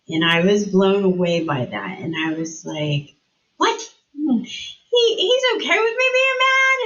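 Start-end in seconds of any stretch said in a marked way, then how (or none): 3.6-6.8 much higher, rising in amazement; surprised disbelief. Retold or imitated. To the counselor, stunned that God accepts her anger, high surprised. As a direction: squeak it out like you can't believe it.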